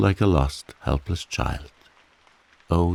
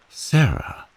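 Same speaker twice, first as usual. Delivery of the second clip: a higher, stunned cry of her name; aghast; 8 semitones higher, 3 dB louder than usual. A narrator's reading. Geoffrey hearing Sarah's question, aghast. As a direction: gasp her name in shock. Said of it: "he said aghast".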